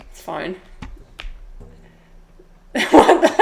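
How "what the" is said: high, loud burst of real laughter; bursting out laughing. Real, not acted. Streamer caught off guard by the chat, high loud laughing fit. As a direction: crack up helplessly on the words.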